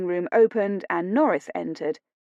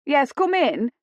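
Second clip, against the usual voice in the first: higher-pitched call toward the door; 7 semitones higher, 4 dB louder than usual. A narrator's reading. Housekeeper answers a knock at the door, calling out. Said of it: "Missus Hodge called out".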